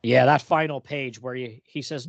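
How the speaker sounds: usual speaking voice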